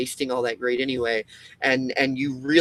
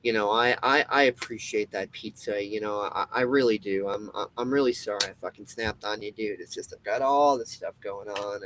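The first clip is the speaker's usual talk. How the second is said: 1 sheepish apology; 5 semitones lower, 4 dB quieter than usual. Retold or imitated. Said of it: "You're probably gonna be like".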